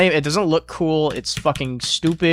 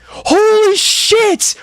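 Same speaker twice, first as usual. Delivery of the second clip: very high, slow, loud shriek; surprised scream; 7 dB louder, much slower than usual. Real, not acted. Gamer barely survives, very high shriek. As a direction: shriek in shock. Close call.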